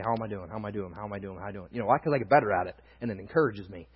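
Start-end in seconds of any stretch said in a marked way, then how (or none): 0.0-1.7 low, very soft, repeated self-talk; muttered self-questioning. Retold or imitated. Preacher imitating his monthly look back, muttered self-questioning. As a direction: murmur it to yourself, trailing away. Retold monthly check on himself.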